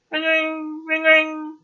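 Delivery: high ring-ring of a phone; telephone ring. A narrator's reading. Narrator imitates the phone ringing at breakfast, in a high sing-song ring.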